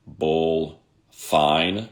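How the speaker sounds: "My bull fine" in three separate, spaced-out words. Retold or imitated. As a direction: pause after each word of "My bull fine". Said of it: "say these three words".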